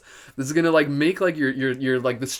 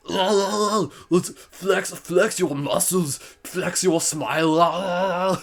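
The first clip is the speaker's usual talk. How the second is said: slow, strained bodybuilder impression; mock Austrian strongman, higher and strained; Arnold Schwarzenegger; 4 semitones higher, much slower than usual. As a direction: flex through every drawn-out word. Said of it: "in my Arnold Schwarzenegger voice".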